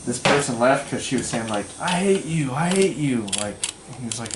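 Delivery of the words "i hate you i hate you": higher, slightly heated imitation; mock angry outburst. Bar patron to the man warning her, mildly mock-angry. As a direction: imitate an irate woman lashing out. Retold irate woman at bar.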